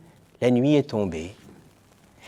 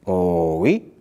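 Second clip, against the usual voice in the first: a lower, half-hearted oh oui; unconvinced fat lady, lower and flat; without conviction; 4 semitones lower, slower than usual. Acted. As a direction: agree but don't mean it. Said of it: "dit sans conviction".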